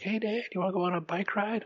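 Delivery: put-on high eager kid voice; daughter voice. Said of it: "my daughter's like".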